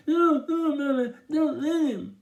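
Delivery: high, loud muffled wail; stuck-lipped mumbling. A narrator's reading. Byron begs Mama not to allow pulling, high muffled mumbling. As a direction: plead frantically through frozen lips. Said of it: "banging his head ... and mumbling".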